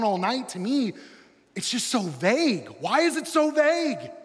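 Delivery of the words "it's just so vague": ordinary talk, nothing marked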